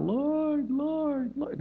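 high-pitched mock wail; crying out. Believers just cry out to God, high mock wailing. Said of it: "We just crying out".

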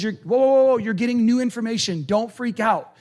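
Paul calming the panicked converts. Retold or imitated. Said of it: "Paul says".

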